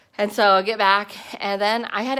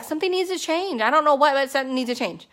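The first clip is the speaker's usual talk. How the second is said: higher, strained pleading to husband; desperate plea; 6 semitones higher than usual. Retold or imitated. Begging her husband at the breaking point, higher, strained plea. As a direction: beg him that things must change.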